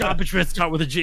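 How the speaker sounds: high, loud, rushed mock outrage; mock exasperation. Repeating the sketch line as the stream ends, exasperated. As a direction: blurt it quickly as a catchphrase. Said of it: "that super exasperated".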